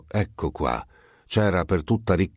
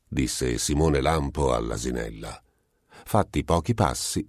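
usual speaking voice

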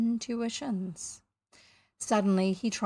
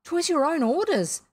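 high, faltering protest; 7 semitones higher, 6 dB louder than usual. A narrator's reading. Bosun excuses himself to angry captain, faltering. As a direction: defend yourself uncertainly, pitched up. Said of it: "the boatswain faltered".

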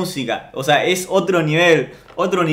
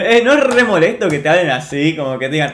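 ordinary delivery

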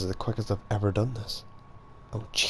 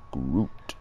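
low, deep Groot voice; Groot impression; 7 semitones lower than usual. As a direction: drop low like the tree creature. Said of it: "that was a good impression".

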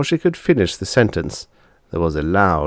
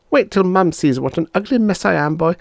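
put-on girl turned chicken, complaining; 13 semitones higher than usual. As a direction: whine at your brother like a cross little girl. Chicken-girl threatens brother.

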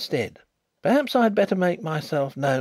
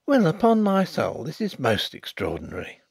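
exclaiming; 6 semitones higher, 5 dB louder than usual. Acted. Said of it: "exclaimed that gentleman".

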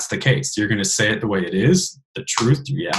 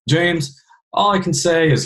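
put-on higher-pitched Louisiana Southern accent; 6 semitones higher than usual. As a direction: imitate a Louisiana father-in-law's Southern accent. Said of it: "here I am with Southern accent again".

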